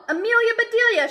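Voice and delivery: high fast shout of her name; boys shouting. A narrator's reading. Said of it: "shouted the boys".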